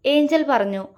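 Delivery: usual speaking voice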